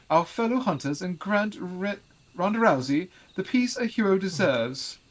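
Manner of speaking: high, loud, slow pompous lord. Acted. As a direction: play a haughty noble, grandly. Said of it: "the pompous voice".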